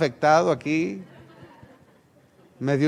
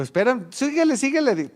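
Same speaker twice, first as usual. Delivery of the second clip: high, urgent call after someone ahead; 8 semitones higher, 3 dB louder than usual. Retold or imitated. Father calls to his son running ahead, in a high urgent call. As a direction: shout after him to keep going.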